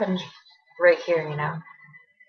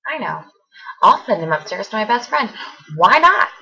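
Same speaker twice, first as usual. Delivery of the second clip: sarcastic mock quote; 2 semitones higher, 4 dB louder than usual. Mimicking friend's supposed reasoning, bitterly sarcastic. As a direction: parody her reasoning with rising outrage.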